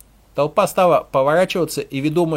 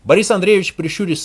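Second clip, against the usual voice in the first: ordinary delivery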